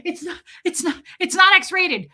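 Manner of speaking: high, flustered protest after a sharp gasp; gasp, then blurt out the denial in a panic; panicked denial. Retold or imitated. Flustered passenger protests to staring strangers, panicked and high. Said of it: "So I was like".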